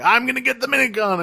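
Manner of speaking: high, quick declaration; hammed-up gung-ho cry; mock eager Starscream. Starscream flying off after the minicon, high mock eagerness.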